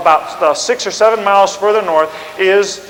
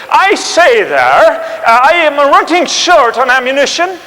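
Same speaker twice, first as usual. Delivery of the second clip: put-on high, polite British accent; very courteous British officer; an Englishman admitting he's out of ammunition; posh officer voice; 7 semitones higher, 5 dB louder, slower than usual. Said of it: "the Englishman would say".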